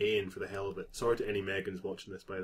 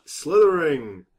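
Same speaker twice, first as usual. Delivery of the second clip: announcing house; 5 semitones higher, 10 dB louder, slower than usual. Acted. Sorting Hat calls the house.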